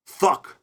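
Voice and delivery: "Fuck" said as thuck, with a th; th instead of f. Shown as a demonstration. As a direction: swap the f in "Fuck" for a th. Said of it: "So it's harder to say".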